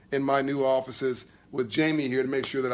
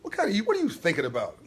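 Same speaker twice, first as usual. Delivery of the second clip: normal talking voice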